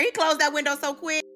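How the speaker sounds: usual speaking voice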